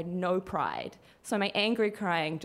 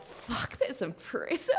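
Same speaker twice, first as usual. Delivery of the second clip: whispered, softer stunned admiration; awed whisper; 2 semitones higher, 5 dB quieter, slower than usual. Retold or imitated. Robbed tourist whispers stunned admiration at the vanished scammers. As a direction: breathe it out, impressed despite yourself. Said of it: "my initial reaction was like".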